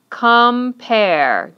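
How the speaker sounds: teacher clapping out two syllables, split COMPARE into com-pare. Shown as a demonstration. Teacher shows students two syllables, "Compare" drawn out by syllable. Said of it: "when we clap it out or we say it".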